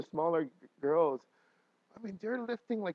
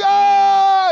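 huge, high sustained scream; yelling; 19 dB louder than usual. Retold or imitated. Husband reenacting yelling with his wife in labor, full yell. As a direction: yell along with the final push. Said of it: "I was yelling with my wife".

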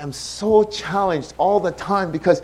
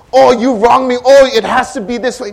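loud, raised-pitch protest; mock indignation; 7 semitones higher, 8 dB louder than usual. Wronged person insisting on their way, mock indignation. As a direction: act out a stubborn complaint, loudly. Acting out a stubborn grievance.

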